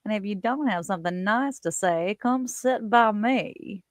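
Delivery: a playful Southern belle imitation, lingering on by; Southern accent. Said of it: "She talked like this throughout the whole movie".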